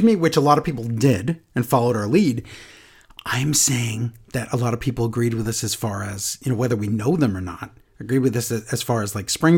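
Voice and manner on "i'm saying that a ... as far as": whisper. Real, not acted. Aside, unvoiced whisper.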